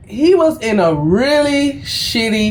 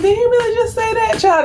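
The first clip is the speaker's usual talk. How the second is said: 1 incredulous; 10 semitones higher, faster than usual. Retold or imitated. Stunned inner reaction to rudeness.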